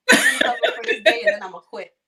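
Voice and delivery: fast, loud, laughing aside; laughing inner thought. Retold or imitated. Caregiver recalling her first day, laughing. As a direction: laugh through the plan to quit.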